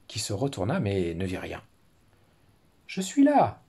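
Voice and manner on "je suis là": high, loud call from under the tree; hidden voice. Acted. Teacher voicing the hidden fox, high and loud. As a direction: sing out from hiding. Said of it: "dit la voix".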